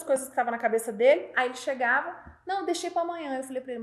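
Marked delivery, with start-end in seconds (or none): 2.4-3.4 higher-pitched offhand excuse; imitated excuse. Employee explaining unfinished errands to the boss, higher-pitched excuse. Funcionário dá desculpa ao chefe.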